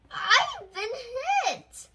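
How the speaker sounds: high, loud, laughing yell of the hit captain; crying out. A narrator's reading. Captain yelling from his burning penguin mobile, high and loud. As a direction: shout it as you get hit. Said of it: "cried the captain".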